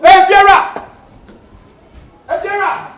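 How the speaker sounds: shouted call, high and loud. Acted. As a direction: yell the name Efiera across the yard. Calls out repeatedly.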